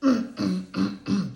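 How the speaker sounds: imitating a creepy driver's humming. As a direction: hum it like a leering creep. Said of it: "who makes those sounds anymore".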